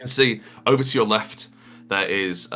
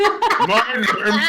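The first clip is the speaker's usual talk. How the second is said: much higher, absurd strained official voice; pushed-to-the-limit voice. Acted. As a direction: push the voice as far as it goes. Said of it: "let's see how far we can push it".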